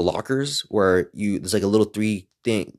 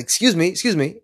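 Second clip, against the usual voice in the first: higher-pitched acted excuse me; calling for attention; 8 semitones higher than usual. Man stopping a girl at a coffee shop, calling for attention. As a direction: get her attention, pitched up.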